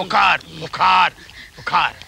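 slow, low, menacing sit down; low slow order to sit. Acted. Villain orders guest to sit.

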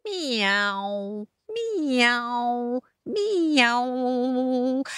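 miaow sliding from high; drawn-out cat call; cat meow. Acted. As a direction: mew like a cat showing off. Imitates cat as lead guitarist.

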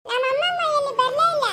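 put-on high little girl's complaint; child voice. Little girl complains grandma never came, high pouting voice. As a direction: pout it like a small child.

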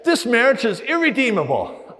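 high, loud, overwrought voice of feelings; dramatic despair. Acted. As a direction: cry it out as the lying feelings. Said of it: "Your feelings say".

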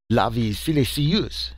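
half-hearted French outburst; mild French shout. Acted. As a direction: exclaim it like an excited Frenchman. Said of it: "shouted Leroy".

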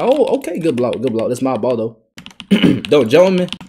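ordinary delivery

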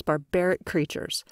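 plain reading voice

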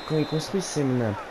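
normal talking voice

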